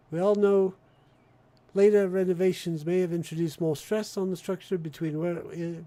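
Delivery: put-on British accent with Australian A's; mock-official British public notice; British announcement. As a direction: read the bulletin as a British broadcaster. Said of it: "My A's are all Australian, while my, the rest of it's British".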